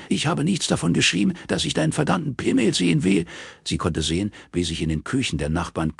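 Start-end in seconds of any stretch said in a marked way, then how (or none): 0.1-3.2 angry yell, pitched well higher. Acted. Robin yelling at Morris down the phone, furious. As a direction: bellow it into the phone. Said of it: "brüllte Robin ihn an".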